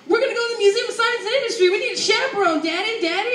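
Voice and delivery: put-on high, rushed excited child voice; imitating an eager child begging Daddy; jazzed kid. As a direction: gush like a kid bursting in from school. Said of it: "I come from school just jazzed".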